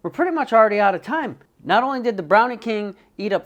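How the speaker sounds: high, loud announcer delivery; show host intro. Acted. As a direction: open the show big and high.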